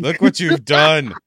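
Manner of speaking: loud, slow, high accusation; mock scolding, loud. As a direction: drag it out as a hammed-up scold. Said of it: "mock scolding after soundboard".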